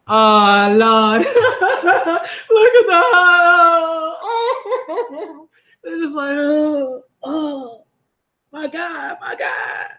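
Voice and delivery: high laughing and crying together; laugh-sob, completely overcome. Real, not acted. Reader watching the characters hug, laughing through tears. Emotional reunion scene.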